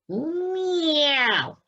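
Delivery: high drawn-out falling meow; cat's slow meow; long cat meow. Acted. Cat meows.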